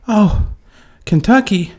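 high, ditzy mock-surprised voice; mock clueless lady. San Diego lady asking about roads, high and mock amazed. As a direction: ask it like a clueless tourist. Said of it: "and she said".

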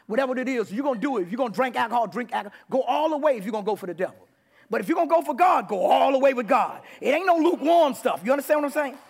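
loud, high preacher's exhortation; fired-up preaching. Real, not acted. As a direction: build to full-force preaching. Preaching at full force.

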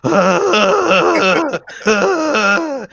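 high, loud, drawn-out fake sobbing wail; mock bawling. Fan reliving the hug scene for friends, mock bawling. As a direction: bawl in hammed-up heartbreak. Imitates own crying at scene.

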